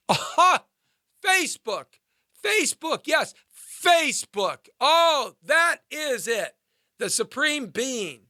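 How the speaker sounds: high, laughing mock glee over Facebook; sarcastic mock excitement. Mocking the audience's Facebook faith with sarcastic glee. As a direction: ham up the fake awe, then a shouted Oh.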